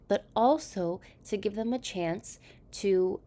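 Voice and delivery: usual speaking voice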